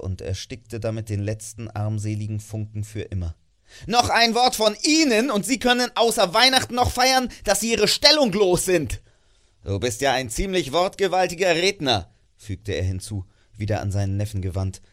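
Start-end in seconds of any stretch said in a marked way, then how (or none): 3.9-9.0 put-on loud, high, furious old miser; angry threat. Scrooge threatens his clerk with dismissal, loud and furious. 9.6-12.1 put-on sneering, raised old voice; mocking miser. Scrooge sneers at his nephew's speech, mocking.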